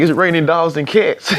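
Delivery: giggling through the joke; laughing. Retold or imitated. Joking back to the sheriff in the rain, laughing. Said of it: "we just bust out laughing".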